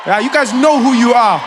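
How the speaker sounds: loud pointed callout; called out. Real, not acted. [name] calling out South Africans in the crowd, loud. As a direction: point at the crowd and call them out.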